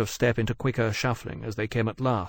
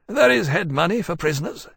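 lightly weary, raised voice; weary captain; 8 semitones higher, 4 dB louder than usual. A narrator's reading. Captain coaxing his dragon to spare prisoners, weary. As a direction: reason with her in a higher voice. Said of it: "he added wearily".

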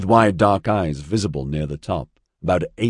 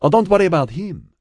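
put-on high, loud Mediterranean-accented reassurance; 12 semitones higher, 5 dB louder, faster than usual. A narrator's reading. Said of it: "a strong northern Mediterranean accent".

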